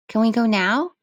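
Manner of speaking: put-on high, bright child asking; a small girl wondering aloud; little girl voice. Child voice Lilica.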